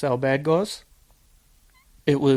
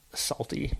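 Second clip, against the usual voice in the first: a breathy whispered word, much softer; 11 dB quieter than usual. Real, not acted. Describing the shift boss, whispered first. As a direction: drop to a whisper on salty.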